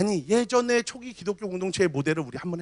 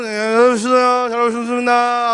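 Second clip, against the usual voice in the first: loud stiff greeting; 3 semitones higher, 8 dB louder than usual. Retold or imitated. Pastor mocking congregants greeting loudly but stiffly. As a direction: bellow the welcome at nobody in particular. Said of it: "하고 앞에 본다고".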